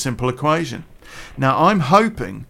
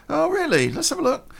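mock innocent. Plays himself, mock innocent.

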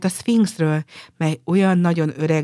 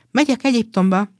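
higher, louder joyful call; happy cry; 5 semitones higher, 5 dB louder than usual. A narrator's reading. Swallow calls out gladly at the harbour. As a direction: shout it out with delight. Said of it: "kiáltotta boldogan a fecske".

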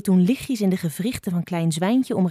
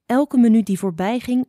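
usual speaking voice

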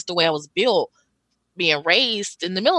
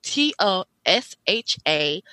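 slow letter-by-letter spelling; over enunciating; slower than usual. Shown as a demonstration. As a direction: say each letter slowly and clearly. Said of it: "So I'll say it slowly".

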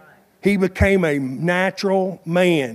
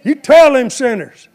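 high, loud shouting praise; excited amen shouter; 6 semitones higher, 6 dB louder than usual. Retold or imitated. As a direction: cheer the preacher on loudly.

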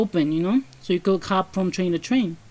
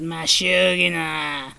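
very slow, drawn-out eerie croon; creepy woman's voice; 3 semitones lower, much slower than usual. Retold or imitated. Telling friends how the subway woman talks, in a slow eerie croon. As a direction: stretch every sound like the creepy lady. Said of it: "She literally talks like that".

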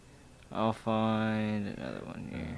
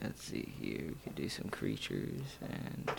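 whispering; 12 semitones lower, 12 dB quieter than usual. Real, not acted. Muttering to self while searching.

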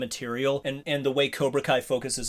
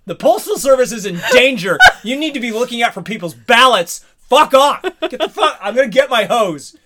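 laughing, shouted rant, much higher; mock furious yell; 8 semitones higher, 10 dB louder than usual. Ranting at the movie postman, laughing mock fury. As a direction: yell him off the lawn through laughter.

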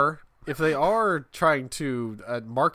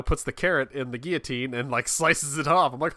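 normal talking voice

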